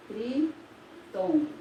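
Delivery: "Tritongo" drawn out slowly, each syllable spaced; teacher says "Tritongo" slowly to let students hear its syllables; slow and clear. Shown as a demonstration. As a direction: spell out "Tritongo" slowly for the class.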